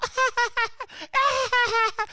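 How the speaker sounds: a high fake flirty giggle; mock high giggle. Mocking a woman's fake laugh at a bad joke, high giggle. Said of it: "That shit is feminine".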